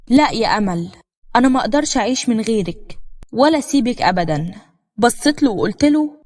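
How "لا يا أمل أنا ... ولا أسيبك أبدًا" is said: plain reading voice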